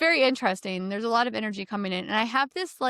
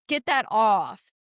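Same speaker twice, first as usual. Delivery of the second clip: slow, louder put-on voice; a movie character's slow, louder order; slow mock demand; 5 dB louder, slower than usual. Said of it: "and he's like".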